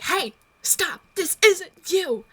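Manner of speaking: high, stretched-out call to stop; urgent plea. Acted. Druid calls to an attacking comrade, acted urgent plea.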